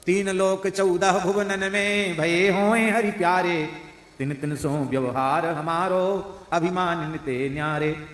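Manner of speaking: slow, half-sung devotional recitation; slow devotional verse. Acted. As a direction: lift the pada slowly like a hymn.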